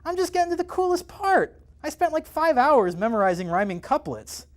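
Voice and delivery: high nasal geeky whine. Acted. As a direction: whine it high through the nose. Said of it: "in a more nasal voice".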